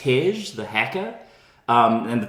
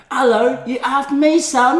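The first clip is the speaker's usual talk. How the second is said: higher Cockney gangster mum impression; mock Cockney; 10 semitones higher, 3 dB louder than usual. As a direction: Helen Mirren's Cockney, hammed up. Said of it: "Cockney as heck".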